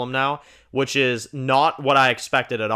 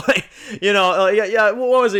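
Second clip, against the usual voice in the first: laughing; 7 semitones higher, 5 dB louder than usual. Real, not acted. Laughs while recalling.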